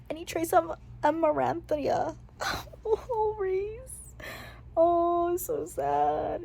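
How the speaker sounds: barely holding back tears over the character; tearful. Real, not acted. Reader moved to tears.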